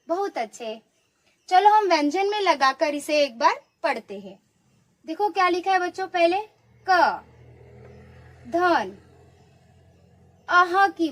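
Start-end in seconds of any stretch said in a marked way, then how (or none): none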